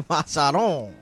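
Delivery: Machado with a rolled Spanish ch and flat open a's; Spanish accent. Shown as a demonstration. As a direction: say "Machado" as a Spanish speaker would. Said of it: "Say that name in your accent".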